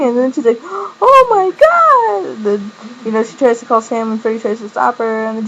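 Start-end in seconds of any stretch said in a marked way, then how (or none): 0.6-2.4 very high, drawn-out squeal; hammed-up high-pitched gasp of disbelief; mock shock. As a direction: squeal it slowly in exaggerated shock. Acted shocked reaction to confession.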